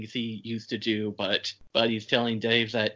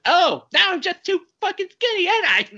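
much higher and louder, mock outraged squeal; hammed-up indignant woman; 7 dB louder than usual. Woman snapping back at Dave's skinny remark, hammed-up outrage. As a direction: hit it like an insulted drama queen. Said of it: "she snaps back at Dave".

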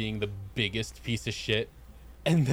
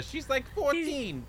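higher, slow mock Joey; 12 semitones higher, 3 dB quieter, slower than usual. Joey voiced protesting.

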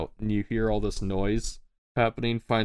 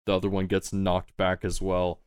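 normal talking voice

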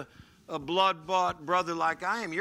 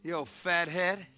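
defiant; slower than usual. Shown as a demonstration. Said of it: "Do this to the devil".

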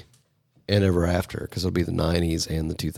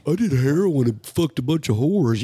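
mock rock star voice; 6 semitones higher, 3 dB louder than usual.

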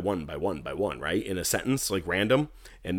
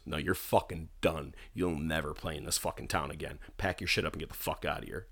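lower, softer mock of an angry boss; low retold dismissal; 5 semitones lower, 10 dB quieter than usual. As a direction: retell the kick-out low and under the breath. Retold owner kicking him out.

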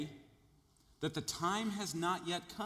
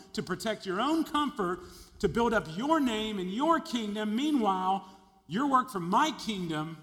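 rising preacher intensity; 5 semitones higher, 5 dB louder than usual. Real, not acted. Preacher building to climax.

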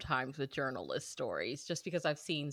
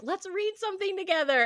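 excited; 13 semitones higher, 3 dB louder than usual. Retold or imitated. Retold excited suggestion.

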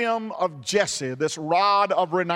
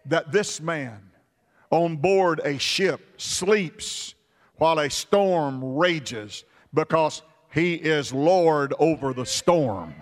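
ordinary delivery

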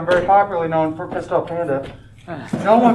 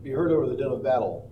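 usual speaking voice

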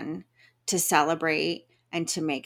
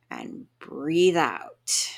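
slow, drawn-out exhale cue; slow breathe out; 2 semitones lower, much slower than usual. Shown as a demonstration. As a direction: stretch it out, slowly. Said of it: "Make the noisiest, loudest".

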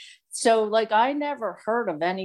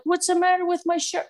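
clueless; 10 semitones higher than usual. Retold or imitated. Clueless at rehab staff.

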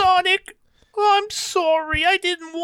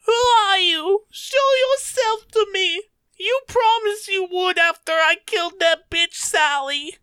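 tearful demand; 6 semitones higher than usual. Acted. Tails cries out to the unseen voice, distraught. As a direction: shout it through tears.

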